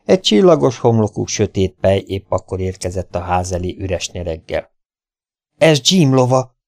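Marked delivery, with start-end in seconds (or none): 5.6-6.6 higher, louder startled cry; stunned outcry, pitched up; shocked exclamation. A narrator's reading. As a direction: exclaim it in alarm. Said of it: "kiáltott fel megdöbbenve Joe".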